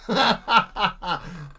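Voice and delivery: short burst of laughter; laughing. Real, not acted. Cracking up at his own lead-paint joke, laughing.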